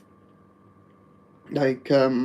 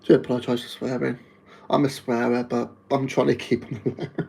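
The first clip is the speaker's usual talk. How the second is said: ordinary talk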